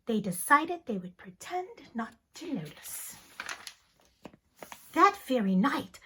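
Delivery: ordinary delivery, nothing marked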